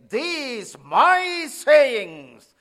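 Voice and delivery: very slow, loud shout of "These my sayings"; shouted emphasis. Shown as a demonstration. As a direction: hammer out each word of "These my sayings". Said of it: "That is the emphasis".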